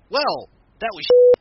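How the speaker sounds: imitating a manager losing patience; mock exasperated shout. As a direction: bark it out in fake disgust. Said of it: "Heyman's like".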